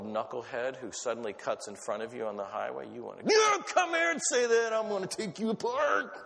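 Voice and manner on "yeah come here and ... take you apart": John Heller road rage. Retold or imitated. Imitating a driver cut off on the highway, mock rage. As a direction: bark at the knucklehead who cut you off, hammed up. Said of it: "that was my John Heller imitation".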